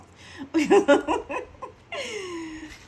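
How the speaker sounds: bright burst of real laughter; laughing. Real, not acted. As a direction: laugh out loud at yourself. Laughs at own silliness.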